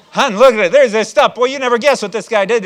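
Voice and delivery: high, loud burst of acted excitement; hammed-up thrilled husband calling out; excited husband. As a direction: burst in to tell your wife big news. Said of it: "I'm all excited about cleaner".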